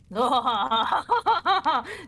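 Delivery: loud, high burst of laughter; delighted laugh. Real, not acted. Player delighted by her new feat, loud laughing.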